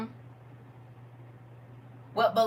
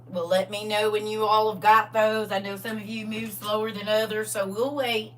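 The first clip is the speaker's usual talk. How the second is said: put-on country Southern accent. Teacher mock-teaching a Bible class, country Southern accent. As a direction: put on a folksy Southern twang. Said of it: "if you talk like that".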